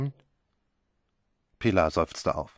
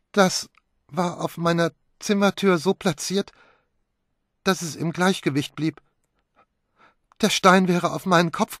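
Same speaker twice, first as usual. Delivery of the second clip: shaken; 7 semitones higher, 3 dB louder than usual. Acted. Pilar shaken after near death.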